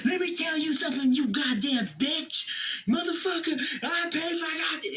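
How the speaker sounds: high hammed-up furious old man; imitating Dad's tirade. Dad cursing out the cashier, in a high hammed-up furious rant. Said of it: "he goes off on a minute tirade".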